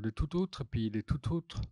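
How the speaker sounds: ordinary talk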